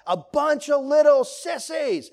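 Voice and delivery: put-on high, loud shouted-out kids' reply; kids' loud answer. Kids answering dad in the car, high loud shout. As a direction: yell it back like a proud little kid. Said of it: "we would all answer really loud".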